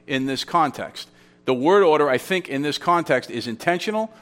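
normal talking voice

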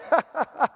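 high-pitched taunting laugh; acted gloating laugh; mocking laughter. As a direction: laugh at their failing efforts. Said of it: "and he's laughing".

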